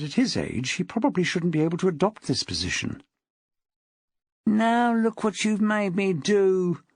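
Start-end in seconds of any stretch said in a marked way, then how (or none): 4.4-6.8 high, drawn-out whine; whining. A narrator's reading. Attacker blaming his victim for the blow, whining. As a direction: whine it slowly, passing the blame. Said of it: "The voice was whining now".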